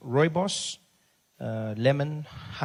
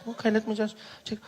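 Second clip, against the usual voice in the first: lightly voiced polite clerk; 7 semitones higher than usual. Clerk mimics pharmacist.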